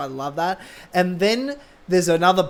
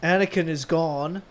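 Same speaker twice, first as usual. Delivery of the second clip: Anakin; 2 semitones lower, 6 dB quieter than usual. Acted. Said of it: "I'll do, I'll do Anakin".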